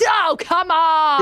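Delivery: slow, drawn-out exasperated dad groan; dad mode. Retold or imitated. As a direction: moan it like a fed-up dad. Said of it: "I was going full dad mode".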